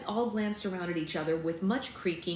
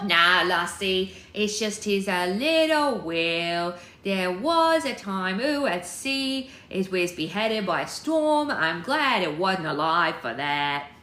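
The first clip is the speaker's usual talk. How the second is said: booming, drawn-out Scottish brogue; loud and slow, an old Scottish figurehead come to life; old Scottish statue; 3 semitones higher, 7 dB louder, slower than usual. Acted. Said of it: "like a Scottish old statue".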